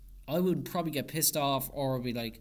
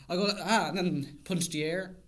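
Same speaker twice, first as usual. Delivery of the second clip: usual speaking voice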